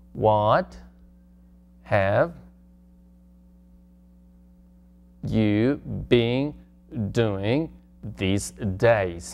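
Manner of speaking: slow, spaced, each word set apart; halting step-by-step demo. Shown as a demonstration. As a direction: build it one word at a time. Teacher builds question word by word.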